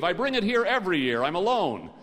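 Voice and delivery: high, loud insistence; overdone, defensive cover story; flustered excuse. A narrator's reading. Dave blurts a flustered excuse.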